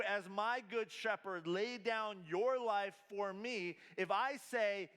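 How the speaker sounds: high, loud, driving sermon delivery; impassioned preaching. Acted. Pastor urging the congregation to truly love Jesus, full-force preaching. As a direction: preach it full force, stretching key phrases slowly.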